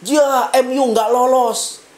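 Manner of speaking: slow, stretched wail of a fussing fan; heboh. Retold or imitated. Guys among friends reacting to their team going out, mock commotion. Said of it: "kalian heboh sendiri bilang".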